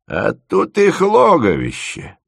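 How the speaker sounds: выше и медленно, с охотой; подхвати с готовностью, по-стариковски; охотно. A narrator's reading. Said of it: "охотно отозвался дед". (дед охотно объясняет студенту про щук)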